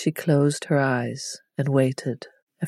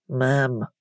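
a pouty, grumpy protest; grumpy pout; 2 semitones lower than usual. A narrator's reading. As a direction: pout the word like a sulky girl. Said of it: "Jules pouted grumpily".

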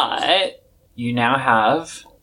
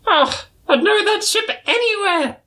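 much higher, knowing dramatic squeak; Old Smoky. Acted. Old Smoky spots the rival ship, high dramatic voice. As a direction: savour the ominous name.

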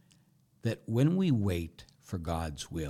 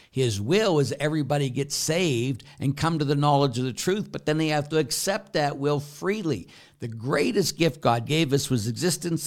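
louder, higher, driving preacher voice; sermon climax on free will; full-force preaching; 6 semitones higher, 7 dB louder than usual. Acted. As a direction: preach it from the pulpit.

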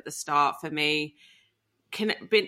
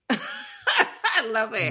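amused laugh; 5 semitones higher, much slower than usual. Real, not acted. Amused by the doctor's name.

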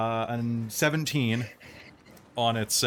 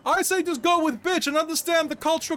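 bro heckler voice; 13 semitones higher than usual. Acted. GM holds heckler voice.

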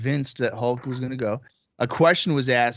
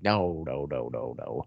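much lower-pitched string of noes; low, dismissive retold refusal; imitated denial; 10 semitones lower, 5 dB quieter than usual. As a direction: drop way down to imitate his denial. Acts Jeff's repeated denial.

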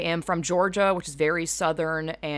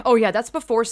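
usual speaking voice

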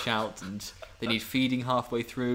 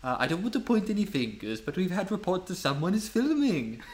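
put-on lilting Welsh accent, pitched up; thick Welsh accent; 5 semitones higher than usual. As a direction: have a go at a polite Welsh usher. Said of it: "he had a very thick Welsh accent".

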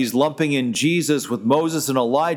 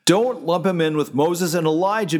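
ordinary delivery

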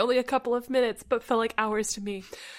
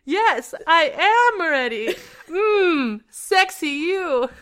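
awkward seductive; 7 semitones higher, 7 dB louder, much slower than usual. Acted. Forced awkward seductive reply.

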